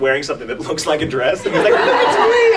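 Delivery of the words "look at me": very high, showy character cry; comically flamboyant squeal; flamboyant France. Retold or imitated. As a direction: show off in a high, hammed-up voice. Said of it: "the first scene we did after that was France".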